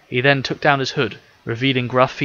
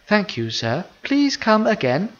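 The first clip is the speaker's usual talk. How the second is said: lightly voiced polite robot, sing-song on again; 10 semitones higher, slower than usual. A narrator's reading. Robot diner server thanking departing customer, polite robot. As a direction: serve the customer sweetly, slightly mechanical. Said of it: "the robot replied politely".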